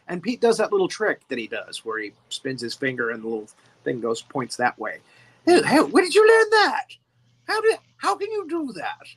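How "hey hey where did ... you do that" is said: a higher, louder mock British accent, lightly done; playing the alien in a hammed-up British accent; Mister Gray British accent. Retelling the film scene, Mister Gray quizzing Pete in a mock British accent. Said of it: "anytime he's talking as Mister Gray".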